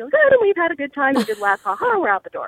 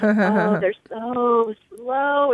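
drawn out; much slower than usual. Retold or imitated. Drawn-out slow kindergartner.

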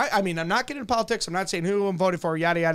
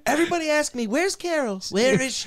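Trump impression; 4 semitones higher than usual. Trump voice bit continues.

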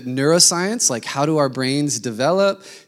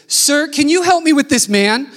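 higher, louder urgent ask; woman's request; 9 semitones higher, 5 dB louder than usual. Retold or imitated. The booth woman asking for help with a man, higher and louder. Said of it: "And she goes".

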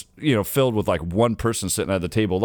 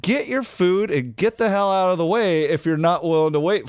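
much higher, incredulous are you serious lady; exasperated disbelief; 7 semitones higher than usual. Retold or imitated. Diner watching a rude customer, high incredulous outburst. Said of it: "I'm standing there going".

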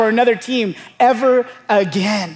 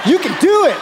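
louder, higher pep talk; rousing encouragement; 8 semitones higher, 5 dB louder than usual. Retold or imitated. Dad urging small son to play baseball, rousing. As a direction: cheer him on like a coach.

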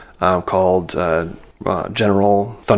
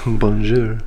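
mock French accent. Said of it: "start saying bonjour to people".

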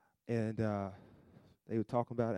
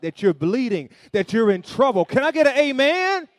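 loud sermon climb to a high shouted amen call; preaching at full force; 4 semitones higher, 11 dB louder than usual. Acted. Pastor rousing the congregation, building to a shouted amen.

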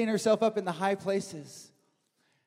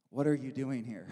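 still small voice; 7 semitones lower, 8 dB quieter than usual. Retold or imitated. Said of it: "what the voice said to him".

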